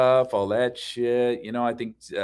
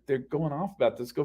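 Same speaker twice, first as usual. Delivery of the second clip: normal talking voice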